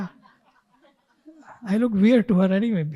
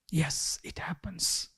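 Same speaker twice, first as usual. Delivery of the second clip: soft, slow whispered acceptance; calm whisper; 3 semitones lower, 12 dB quieter, slower than usual. Shown as a demonstration. Teacher repeats the calm answer to students, whispered. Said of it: "teacher repeats calm answer".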